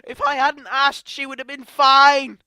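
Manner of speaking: real grief breaking into a shout; shouting through tears; crying out in grief. Real, not acted. Reliving her pain, blaming herself.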